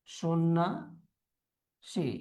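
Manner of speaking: সন্ন্যাসী low, soft, as "sonna ... si"; broken into parts. Shown as a demonstration. Teacher dictating to students, slowly in parts. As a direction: leave a long gap before the end of সন্ন্যাসী.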